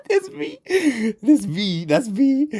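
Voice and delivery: very high, laughing squeal; helpless laughter. Real, not acted. Gamer telling how he owned up as Mister Random, laughing. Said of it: "It was funny as fuck".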